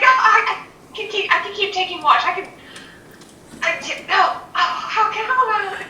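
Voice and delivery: high, stammering, flustered rush. Acted. Young creature scrambling up, flustered and stammering. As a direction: trip over the words, all nerves. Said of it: "scrambles up and is like".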